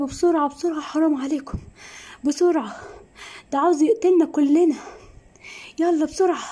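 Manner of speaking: father frantically calling the police; urgent plea to police. Acted. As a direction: beg the police to hurry.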